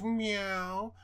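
high mock meow; cat meow. Mocking the cat answering her with a high meow. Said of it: "He goes".

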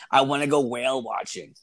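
lightly voiced complaining girlfriend; mock nagging girlfriend. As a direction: nag as if badgering your boyfriend. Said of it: "bitching at me to fucking".

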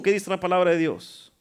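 ordinary talk

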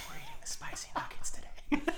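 breathy high ASMR whisper. Pretend. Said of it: "a little bit of an A S M R".

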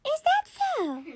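slow, very high squeak; squeaky mum, drawn out; squeaky mummy voice. Acted. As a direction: query it in a tiny toy voice. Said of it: "And Mummy said".

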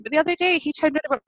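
ordinary talk, nothing marked

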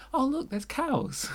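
high, slow, wide-eyed wonder; excited city kid. Retold or imitated. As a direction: gasp at cows like a child. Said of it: "I'm regularly going".

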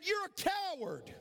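very high call, falling at the end; acted high holler. Boy taunting the unseen voice, high holler. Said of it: "he screamed out".

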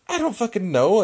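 high, loud comeback; retold exasperated yell. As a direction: act out yelling back, at a loss. Yells back at the crazed lady.

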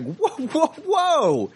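high slow mock outrage; hammed-up shocked whoa; mock outraged yell. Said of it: "I was like".

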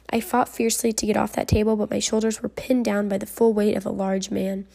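usual speaking voice

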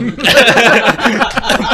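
roaring laugh; howling with laughter; loud burst of laughter. Real, not acted. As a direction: crack up loudly. Friend cracks up.

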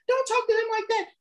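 high mock yell of the sister; imitating yelling sister. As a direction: squeak it like the angry sister. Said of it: "the sister started yelling".

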